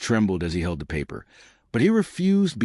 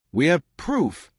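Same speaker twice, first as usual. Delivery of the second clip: cold; 7 semitones higher, slower than usual. A narrator's reading. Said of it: "he said coldly".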